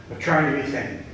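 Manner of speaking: normal talking voice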